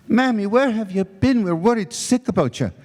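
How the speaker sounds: high, anxious daughter's greeting; a daughter worried sick, high and quick. Acted. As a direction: fret at your mother coming in late.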